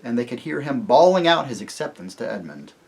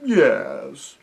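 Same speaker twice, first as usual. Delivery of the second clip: sleepy bear; 2 semitones higher, 5 dB louder than usual. A narrator's reading. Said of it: "said the bear".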